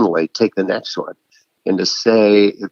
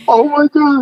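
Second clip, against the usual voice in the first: very high, louder, exasperated cry; mock exasperation; 5 dB louder than usual. Reacting to an author who hasn't read the book, mock dismay. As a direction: throw up your hands in mock dismay.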